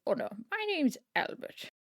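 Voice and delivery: a fussy posh English academic correcting himself; male posh voice. Acted. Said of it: "like, a male posh".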